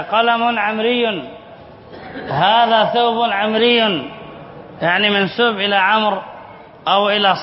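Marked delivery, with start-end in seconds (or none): 0.0-4.1 "عمري" drawn out slowly as an example; ببطء كمثال; slow demo. Shown as a demonstration. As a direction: lay out "قلم عمري" slowly for students. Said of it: "أقول".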